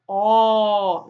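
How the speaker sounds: round, open Ó; open vowel Ó. Shown as a demonstration. Teacher exaggerating the open Ó. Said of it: "O O".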